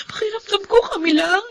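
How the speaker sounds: tearful, breaking, high and soft; crying. Acted. Student breaking down to the dean, crying softly. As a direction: fight through the sobs to get words out.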